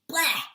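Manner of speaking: spitting out a loud bleh. Shown as a demonstration. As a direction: spit it out with a big bleh. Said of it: "you're gonna spit out and go".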